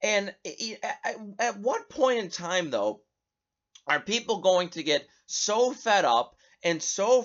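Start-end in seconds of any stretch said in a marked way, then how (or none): none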